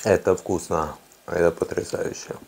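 eating while speaking; talking while chewing. Real, not acted. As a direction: mouth full of fries. Seen 1: Eats fries at 0.3-0.8s, then visibly chews while talking.

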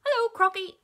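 high, loud monkey hail; cheery monkey. A narrator's reading. Monkey hails crocodile from the tree, high and loud. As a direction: call out a high, chirpy hello.

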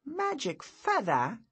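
"magic feather" very high and slow, rising into a question; skeptical. A narrator's reading. Boy repeats "magic feather" back to the wizard, skeptical. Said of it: "he repeated skeptically".

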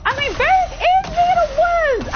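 high-pitched, indignant complaint; exasperated outcry. Real, not acted. Complaining about game companion.

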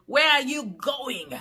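mock the harsh South African way of speaking; harsh South African accent. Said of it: "Jerry was doing his impersonation".

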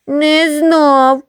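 put-on high, sobbing child answer; sobbing reply. Crying boy answers the teasing schoolboys, sobbing high. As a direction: choke it out through sobs. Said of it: "відхлипував Гриць".

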